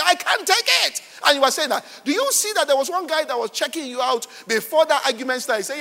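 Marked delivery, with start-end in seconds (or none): 0.0-1.0 pitched up and pushed louder; louder mock complaint. Woman repeats her complaint to the cashier, escalating mock anger. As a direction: repeat it harder, the row boiling over. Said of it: "shouting on the cashier".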